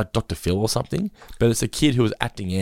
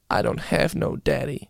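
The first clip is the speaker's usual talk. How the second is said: put-on sad little kid; 4 semitones higher, 4 dB quieter, slower than usual. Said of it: "he's just like".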